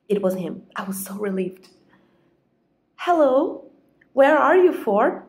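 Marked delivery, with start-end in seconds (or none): 3.0-5.2 high, loud, anxious phone greeting; worried wife straining to sound casual; suppressed panic. Acted. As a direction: try to keep the panic out, not quite managing it. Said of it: "tried to keep the panic out of my voice".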